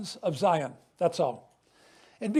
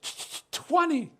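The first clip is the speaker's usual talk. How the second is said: stuttering; 6 semitones higher than usual. Retold or imitated. Abused boy answering his class: a higher, stuttered reply. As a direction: stammer on the first sound, higher. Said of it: "I was stuttering".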